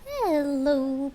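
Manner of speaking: usual speaking voice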